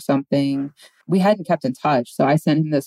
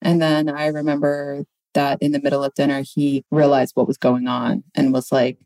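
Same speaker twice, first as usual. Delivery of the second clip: ordinary talk, nothing marked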